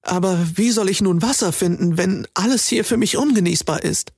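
usual speaking voice

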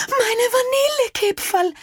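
high wail for her cookies; the mother crying out in dismay; high-pitched lament over the burnt batch. A narrator's reading. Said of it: "rief Frau Moser".

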